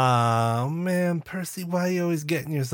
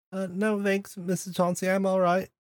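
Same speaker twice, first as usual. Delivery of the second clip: timid Crumpet; 6 semitones higher than usual. Acted. Crumpet turns down advice to run, timid. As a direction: decline politely in a small raised voice.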